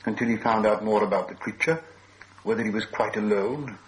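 ordinary delivery

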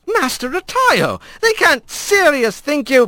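high, anxious plea; lightly voiced flustered cook; flustered servant. A narrator's reading. Cook pleading with the angry father, high and anxious. Adler protesting, high pitched.

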